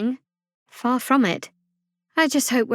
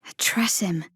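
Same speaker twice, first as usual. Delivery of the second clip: breathless whispered discovery; 4 semitones lower, 4 dB quieter than usual. Acted. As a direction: hush it in wonder. Sneaking, spots a creature.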